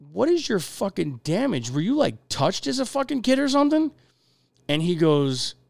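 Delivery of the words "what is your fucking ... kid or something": incredulous outburst. Retold or imitated. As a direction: voice jumps way up in disbelief. Retelling his outburst at an inmate.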